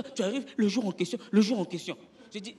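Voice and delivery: ordinary talk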